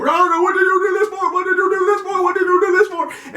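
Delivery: high, shrill mock of a parent scolding; yelling parent. Parent scolding a kid who did wrong, high mock yell. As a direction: squeal it like a frantic parent.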